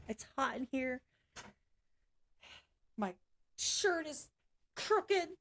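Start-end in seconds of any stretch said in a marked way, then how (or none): 3.6-5.3 high, whiny fake-crying complaint; much higher bratty fake whine; mock whining. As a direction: pretend sob like a pouty kid. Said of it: "I'm just kidding".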